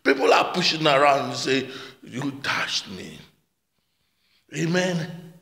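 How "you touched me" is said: quiet quoted question. Retold or imitated. Retelling disciples quoting Jesus' question.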